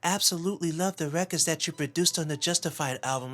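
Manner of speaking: high-pitched gushing pop star impression. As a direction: gush with admiration in a put-on high voice. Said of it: "M J goes".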